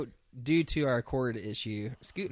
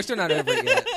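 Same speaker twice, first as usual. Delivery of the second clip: laughing; 10 semitones higher, 12 dB louder than usual. Real, not acted. Mock grudge, laughing.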